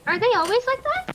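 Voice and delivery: curious question. Acted. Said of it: "and goes".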